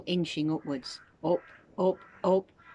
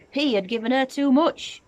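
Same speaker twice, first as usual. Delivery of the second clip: higher, louder worried narration; rising panic; 8 semitones higher, 6 dB louder than usual. A narrator's reading. Narrator tells George's panic, higher and louder. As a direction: let the guilt spike.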